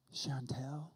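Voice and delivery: a hushed, secretive whisper of her name. Retold or imitated. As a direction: let the name slip out as a secret. Brother confides about a girl.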